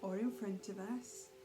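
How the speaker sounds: calm, even meditation voice with long pauses; guided meditation. Real, not acted. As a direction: ease them gently through each breath, unhurried. Leads a guided breathing meditation.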